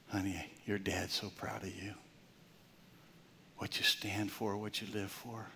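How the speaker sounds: tender whispered blessing; father whispers pride to his son; soft whisper. Retold or imitated. Father's loving words retold.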